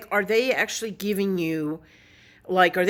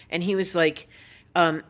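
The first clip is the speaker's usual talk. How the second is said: normal talking voice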